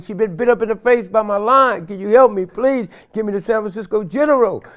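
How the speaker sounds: high, loud desperate pleading; desperate plea. Retold or imitated. Father begging a stranger to drive his hurt daughter, desperate. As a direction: beg for a ride.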